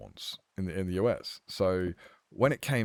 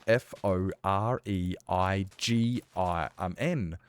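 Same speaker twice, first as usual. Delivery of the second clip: slow letter-by-letter spelling; spelling out slowly; slower than usual. Shown as a demonstration. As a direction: spell foreign out one letter at a time. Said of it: "foreign is spelt".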